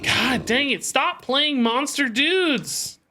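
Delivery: frustrated yell at the game, drawn out; annoyed cry at the screen; exasperated outburst. Real, not acted.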